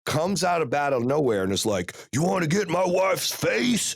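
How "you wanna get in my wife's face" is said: high-pitched mock confrontational yell; imitating loud coach. Rival coach confronts him over his wife, mock loud challenge. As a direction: mimic a burly coach getting loud, pitch jumping. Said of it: "starts getting all loud".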